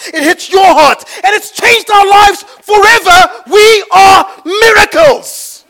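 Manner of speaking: loud, high impassioned preaching; fiery sermon peak; preaching at full force. Real, not acted. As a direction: drive it home to the congregation. Preaching climax.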